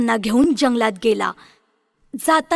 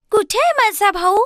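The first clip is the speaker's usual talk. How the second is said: put-on higher girl's voice, anxious; worried Gretel; 8 semitones higher than usual. Gretel asking the witch where her brother is, higher and worried. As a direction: ask in alarm.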